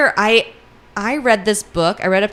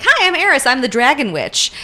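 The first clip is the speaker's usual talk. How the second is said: high, louder, mock-perky greeting; chirpy witch introduction; 6 semitones higher, 4 dB louder than usual. Evil witch introduces herself to Landon, chirpy. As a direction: introduce yourself high and loud as a cheeky witch.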